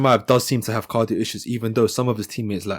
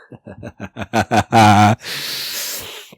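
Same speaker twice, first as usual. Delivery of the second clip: loud laugh; 6 dB louder than usual. Real, not acted. Laugh after mock threat.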